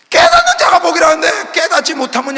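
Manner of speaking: high, loud shout with a sob in it; impassioned weeping cry from the pulpit; tearful preaching. Acted. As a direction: plead through tears. Preaching with a crying edge.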